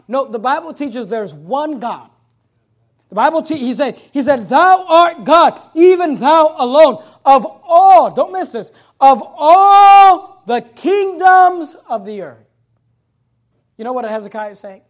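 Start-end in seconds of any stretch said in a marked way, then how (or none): 3.1-12.4 loud, high pulpit cry with drawn-out key words; full-force preaching. Acted. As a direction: proclaim the scripture, stretching the big words.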